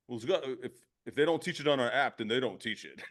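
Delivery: lightly voiced clueless app kid; a hint of a smug know-it-all; mock clueless millennial. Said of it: "I'm like".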